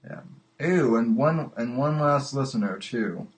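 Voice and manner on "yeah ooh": ordinary delivery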